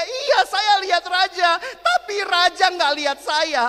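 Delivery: put-on girl crying that the king never saw her. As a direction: wail out the heartbreak. Crying girl's punchline.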